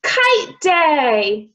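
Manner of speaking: loud, high Kite Day; excited shout. A narrator's reading. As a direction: cheer it out like a happy bear. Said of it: "then shouted".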